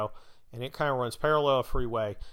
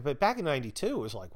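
plain reading voice

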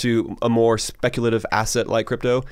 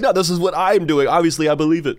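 crypto bro voice; 7 semitones higher than usual. Retold or imitated. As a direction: play the smug crypto hype guy. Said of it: "That's my crypto bro voice".

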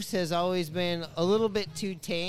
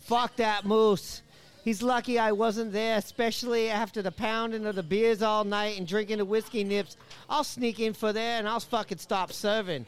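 put-on heavy Boston accent, higher pitched; 5 semitones higher than usual. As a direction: drunk Red Sox fan, Boston accent. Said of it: "try it in a Boston accent".

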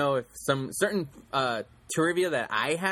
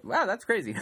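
laughing; 10 semitones higher than usual. Real, not acted. Reacts to casting news.

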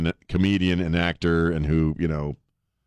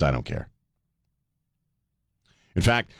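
ordinary talk

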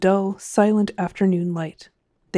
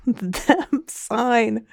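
higher-pitched, laughing disbelief; helpless derisive laughter; 4 semitones higher than usual. A narrator's reading. Mister Ee holding himself up by his desk, helpless laughter. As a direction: squeeze the words out through laughter. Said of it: "let his laughter jerk out".

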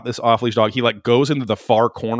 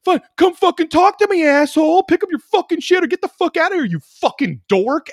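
high-pitched, loud mock tirade; imitated angry yelling; 4 dB louder than usual. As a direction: imitate a belligerent stranger yelling. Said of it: "he was yelling at me".

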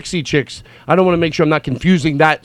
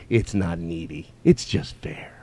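whispered; 12 semitones lower, 9 dB quieter, slower than usual. Real, not acted. Quiet final point.

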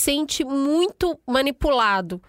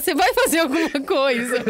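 laughing question; 3 semitones higher than usual. Retold or imitated. Retelling reply to daughter, laughing.

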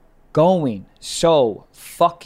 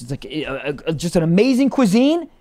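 ordinary talk, nothing marked

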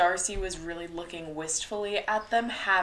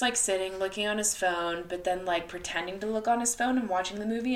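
normal talking voice

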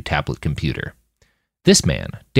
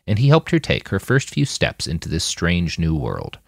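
ordinary delivery

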